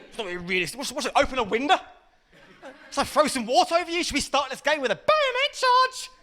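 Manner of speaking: rising to a high-pitched rant; worked-up granddad. Acted. Granddad mocking a game of battleships, escalating high rant.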